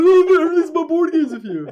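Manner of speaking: loud, very high mock freak-out; hammed-up high-pitched outburst; imitating wife flipping out. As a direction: mimic an over-excited wife, breaking into laughter. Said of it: "my wife was flipping out".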